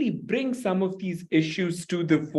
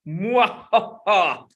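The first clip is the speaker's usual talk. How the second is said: slow evil mwahaha; sneering panto villain; 2 semitones higher, much slower than usual. Acted. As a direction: cackle like a pantomime baddie. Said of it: "That was scary".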